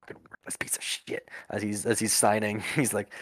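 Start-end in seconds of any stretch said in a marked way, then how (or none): 0.0-1.3 whispered cursing under the breath; hushed, angry grumble; muttered insult. Acted. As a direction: mutter it very softly, voiceless. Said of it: "you just hear mutter".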